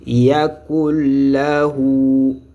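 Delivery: very slow, stretched Yakul lahu; joined model reading. Shown as a demonstration. Teacher showing how the two words are read, drawn out. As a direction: draw out the vowels and l of Yakul lahu. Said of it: "C'est comme ça maintenant qu'il faut lire".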